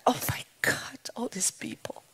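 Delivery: hushed, awed whisper of a nervous newcomer; whispered "oh my God" in awe; scared whisper. Retold or imitated. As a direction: breathe it out, frightened of the room. Scared to death.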